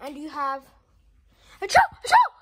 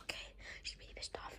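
whisper; 19 dB quieter, much faster than usual. Real, not acted. Whispering aside to camera.

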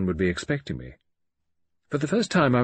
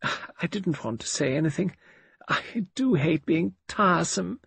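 angelic martyr; 10 semitones higher than usual. A narrator's reading. Patient apologising for being tiresome, sweetly martyred. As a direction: play the sweet martyr in a high voice. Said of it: "her angelic martyr's smile".

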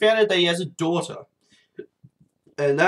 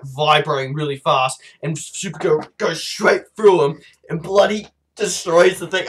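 ordinary delivery

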